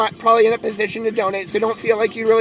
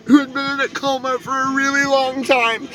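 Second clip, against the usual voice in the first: crying while talking; 3 semitones higher than usual. Real, not acted. Remembering uncle who died.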